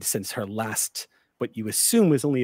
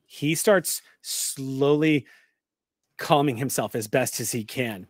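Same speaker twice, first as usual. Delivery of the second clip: ordinary talk, nothing marked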